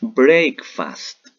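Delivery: "Breakfast" said loud and slow in English, as a model for the class; modeling English word. Shown as a demonstration. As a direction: say "Breakfast" clearly for pupils to repeat. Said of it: "Repitan conmigo". ("Breakfast" pronunciado fuerte y claro en inglés para que repitan)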